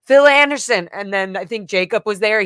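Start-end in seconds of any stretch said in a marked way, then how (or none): 0.1-0.9 retold call of a name, higher and louder. Daughter reenacting calling out her father's name. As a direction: call the name across the street.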